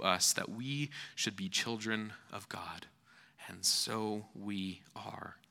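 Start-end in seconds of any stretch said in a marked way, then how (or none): 3.5-5.4 slow, hushed whisper. Acted. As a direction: breathe it in awe. Hushed awe after the verse.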